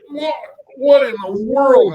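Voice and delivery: high, loud, slow "What in the world?"; stunned outrage. Retold or imitated. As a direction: hit by a cookie, shocked. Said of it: "And I'm like".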